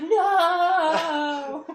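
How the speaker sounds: long, high, loud wailed "no"; hammed-up drawn-out cry of despair; mock wail. Drunk woman whose phone is ruined, mock anguished wail.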